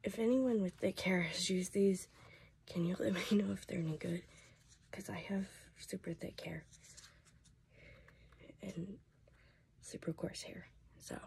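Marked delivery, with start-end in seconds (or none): 2.7-11.2 hoarse whisper; voice giving out. Real, not acted. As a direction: voice nearly shot. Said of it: "my voice is about shot".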